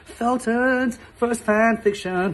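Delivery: slow, grand title read; show title announcer. Shown as a demonstration. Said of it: "not a bad name for a show".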